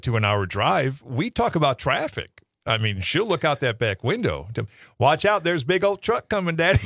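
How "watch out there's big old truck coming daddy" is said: Southern dog voice, a bit higher; Southern accent. Retold or imitated. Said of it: "she answers in a Southern accent".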